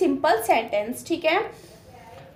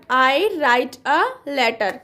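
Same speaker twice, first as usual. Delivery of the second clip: very slow, each word drawn out; slow dictation; much slower than usual. Shown as a demonstration. Teacher to class, reading the translation slowly. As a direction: spell the English out slowly for the class. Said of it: "तो आई क्या लिखेंगे आप".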